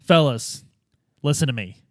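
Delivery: ordinary talk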